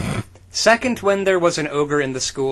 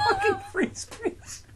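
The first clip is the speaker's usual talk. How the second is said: laughing; slower than usual. Real, not acted. Cracking up at the line.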